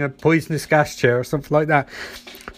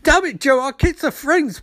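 mock outrage; 8 semitones higher than usual. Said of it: "Henry's like".

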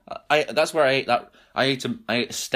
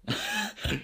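Gators breathed as a whisper; whispered Aussie Gators try; 9 semitones higher, 8 dB quieter than usual. Shown as a demonstration. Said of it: "say gators in an Australian accent".

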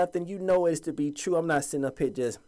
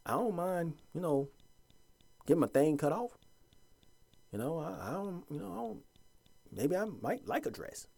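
mock the brothers timidly; meek, softly voiced caricature; mocking quote; 3 semitones higher, 8 dB quieter than usual. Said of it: "brothers talking about".

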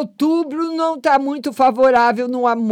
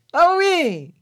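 calling out; 7 semitones higher than usual. Real, not acted. Greeting caller on the live.

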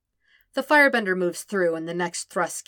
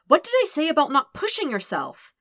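higher, louder scolding; 10 semitones higher, 6 dB louder than usual. Acted. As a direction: scold him like a worried healer. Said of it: "she scolds".

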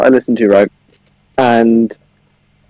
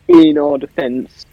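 mock dramatic; 12 semitones higher, slower than usual. Dramatic high slow defense.

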